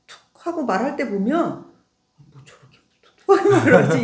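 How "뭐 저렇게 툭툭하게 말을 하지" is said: higher and louder, fast and incredulous; mock indignant thought, sped up; exasperated inner grumble. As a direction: blurt it like you can't believe his bluntness. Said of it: "이런 생각이 들 때 있는 거야".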